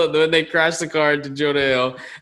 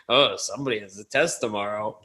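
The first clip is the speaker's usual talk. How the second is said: mock sarcasm; 6 semitones lower, 6 dB quieter than usual. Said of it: "Seth Rogen goes".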